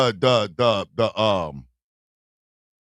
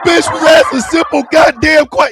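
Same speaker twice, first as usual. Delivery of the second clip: rising, louder exasperated rant; mock outraged yell; 8 semitones higher, 6 dB louder than usual. Retelling a pushy question, half-yelled in mock outrage. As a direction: yell it like you can't believe it.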